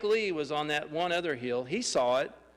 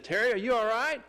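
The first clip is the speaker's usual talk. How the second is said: worried call; 6 semitones higher than usual. Retold or imitated. The pastor, running to the fallen Terry, a worried call. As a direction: call out in a fright to a fallen friend. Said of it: "He said".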